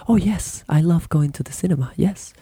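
high, quick, delighted yes; the other person in the bar, accepting the invite in a high excited voice; eager reply. Pretend. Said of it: "the other person says".

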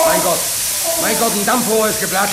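high, loud panicked cry; frightened yell over the hissing steam; panicked alarm. Acted. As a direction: shout in shock as the pipe bursts. Steam pipe bursts in engine room.

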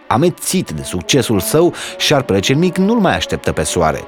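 usual speaking voice

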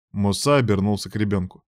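ordinary delivery

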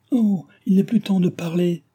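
high-pitched, urgent outcry; urgent cry. A narrator's reading. Cécile begs help for her husband, urgent cry. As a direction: cry out as if every second counts. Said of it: "s'écria-t-elle".

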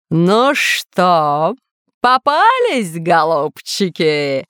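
drawn-out, menacing taunt; villain's slow, swooping taunt; gloating villain. Acted. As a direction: gloat over the catch, stretching the last word.

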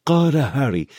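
usual speaking voice

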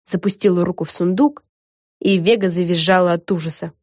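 plain reading voice

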